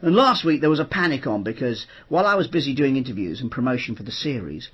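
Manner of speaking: usual speaking voice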